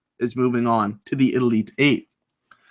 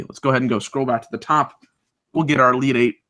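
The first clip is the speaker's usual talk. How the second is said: ordinary delivery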